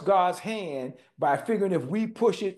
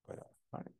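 whisper; 22 dB quieter than usual. Retold or imitated. God's inner voice answering.

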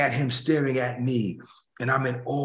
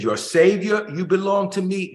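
higher, louder, possessive voice; proud, claiming tone; 7 semitones higher, 3 dB louder than usual. Pretend. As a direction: say it like a master claiming what is his. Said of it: "that would take a different tone".

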